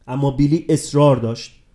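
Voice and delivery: ordinary delivery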